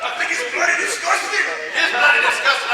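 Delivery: normal talking voice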